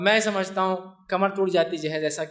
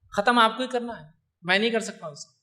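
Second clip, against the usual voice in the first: normal talking voice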